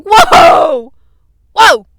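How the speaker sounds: very loud, high shout; hammed-up startled yelling; shocked whoa. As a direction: yell whoa as if knocked back. Acts reaction to being screamed at.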